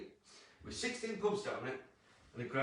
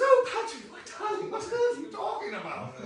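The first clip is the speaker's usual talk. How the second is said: high, plummy posh mother voice, much higher; hammed-up posh mum putting on airs; 8 dB louder than usual. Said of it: "she's actually quite posh".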